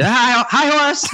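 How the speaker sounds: high-pitched call from the crowd; heckler shout. Acted. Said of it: "when it gets really quiet".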